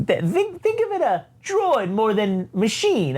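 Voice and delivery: high, pleading droid voice; high-pitched droid argument; evil droid. Acted. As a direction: stammer out a high protest. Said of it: "evil ass triple zero pops in and says".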